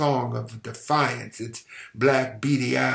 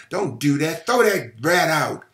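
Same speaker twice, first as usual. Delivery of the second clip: scolding; 4 semitones higher, 5 dB louder than usual. Acted. Mother scolding her son.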